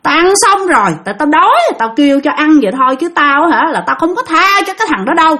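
acted high voice of a haughty possessed man; possessing spirit. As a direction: refuse them as an unforgiving spirit. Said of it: "Nói vậy nè".

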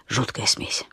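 low, near-whispered verdict; hushed aside. A narrator's reading. Narrator commenting on a grotesque cocktail recipe, hushed aside. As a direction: drop to a whisper, as if confiding.

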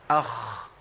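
اخ with the خ held, breath running out; أخ بخاء ساكنة مهموسة; sukoon kha. Shown as a demonstration. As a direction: say اخ letting air hiss through the خ. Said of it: "ركزوا معايا تاني".

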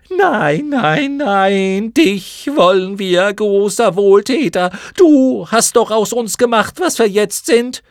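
very high, loud, slow mocking shriek; screaming chorus. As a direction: jeer it back together. Chor besteht auf dem Jungen. (Mottenchor schreit höhnisch und sehr hoch)

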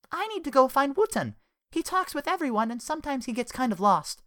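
high, lively woman; chatty woman voice. Acted. Penny's voice in story.

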